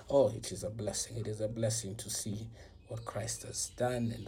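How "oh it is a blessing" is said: ordinary talk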